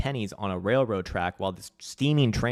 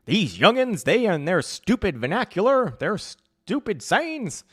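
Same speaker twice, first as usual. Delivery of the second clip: high, loud cranky elder; hammed-up crotchety old voice; grumpy old-timer; 10 semitones higher, 5 dB louder, slower than usual. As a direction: rant like an old person scorning kids. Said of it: "I'm definitely getting old".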